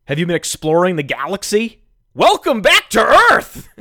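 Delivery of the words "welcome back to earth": loud, high, slowed shout; a real outburst breaking the calm promise. Real, not acted. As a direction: yell it out in disbelief. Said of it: "Did I just raise my voice?".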